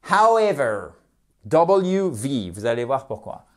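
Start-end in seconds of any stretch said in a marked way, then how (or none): 0.1-0.9 'However' high and clearly articulated; clear, weighty 'However'; careful pronunciation. Shown as a demonstration. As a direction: model 'However' emphatically for learners. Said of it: "attention à la prononciation".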